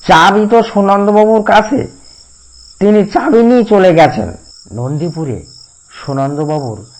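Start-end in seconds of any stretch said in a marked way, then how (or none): 0.1-4.4 higher, louder servant's whine; nasal whiny voice. A narrator's reading. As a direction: keep Tilak's nasal whine going. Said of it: "তিলক খনখনি গলায় উত্তর দিল". (খনখনে গলায় তিলক)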